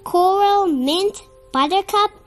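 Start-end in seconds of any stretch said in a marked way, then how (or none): none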